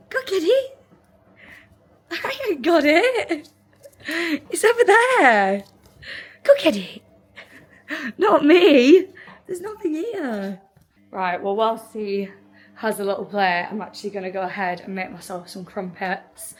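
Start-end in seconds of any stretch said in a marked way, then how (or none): 0.1-10.6 high and loud, playful teasing; dog voice. Real, not acted. Owner teasing her puppy during fetch, loud and high. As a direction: tease the puppy into chasing.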